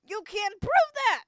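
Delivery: high, loud indignant denial; shouted defensive you can't prove that. Acted. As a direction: blurt it out caught out. Voicing defensive character.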